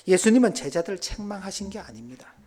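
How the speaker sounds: usual speaking voice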